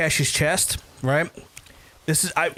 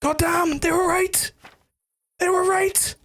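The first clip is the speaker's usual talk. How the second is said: much higher, amazed voice; high astonished squeal; high disbelief; slower than usual. Retold or imitated. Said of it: "And then I was like".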